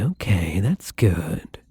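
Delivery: usual speaking voice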